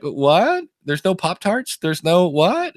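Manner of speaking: high, disbelieving questions at the candy stand; mock surprise. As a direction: act baffled that the snack is missing. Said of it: "you're like".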